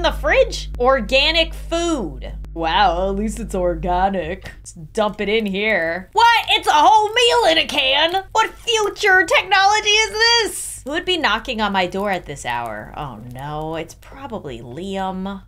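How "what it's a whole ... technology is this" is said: high and louder, mock-astonished; amazed. Real, not acted. Player marvelling at canned food, high and excited. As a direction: gush over a canned meal like a miracle.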